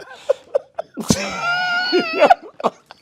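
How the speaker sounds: high, shrieking, loud laughter; screaming laugh. Real, not acted. Laughing hard at his own punchline, high shriek. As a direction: howl with laughter.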